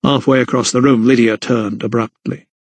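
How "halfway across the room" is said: usual speaking voice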